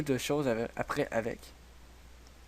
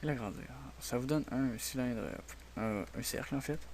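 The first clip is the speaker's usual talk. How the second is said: softer, half to himself as he clicks; speaking softly; 9 dB quieter than usual. Real, not acted. Tutorial host explaining the cylinder tool to viewers, quiet. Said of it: "Désolé si je parle pas fort".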